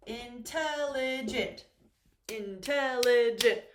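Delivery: slow "intelligent" in four beats; syllable by syllable. Shown as a demonstration. Teacher counting syllables for learners, "intelligent" slowly in four beats. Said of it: "and four syllables here".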